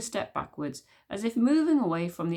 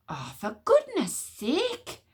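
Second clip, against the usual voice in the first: slow, high weary sigh; exasperated sigh; 9 semitones higher, much slower than usual. Acted. Queen fed up with the witch smeller, sighing slowly. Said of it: "with a sigh".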